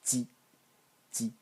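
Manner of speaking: ji as a slightly voiced burst; soft voiced burst for ji; Chinese ji. Shown as a demonstration. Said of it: "slightly voiced".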